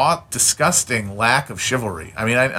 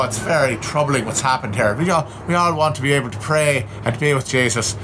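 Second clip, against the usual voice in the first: mock Irish accent. Cardinal talking the victim's family out of an autopsy, mock Irish accent. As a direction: play a concerned Irish cleric, accent laid on thick. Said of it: "That was a good accent".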